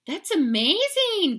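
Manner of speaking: high, loud, stretched-out gush. A narrator's reading. Girls admire their brother's tricks, high gushing. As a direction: gush with wonder, drawing the word out. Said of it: "gushed the girls".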